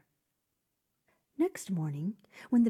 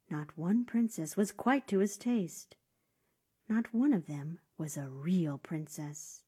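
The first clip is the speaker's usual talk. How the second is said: ordinary delivery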